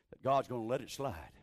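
ordinary talk

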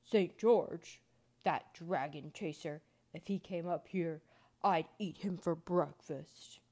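loud, scornful giant voice; big menacing storybook giant; snorting giant. A narrator's reading. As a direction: boom it with contempt, heavy and slow. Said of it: "The giant snorted".

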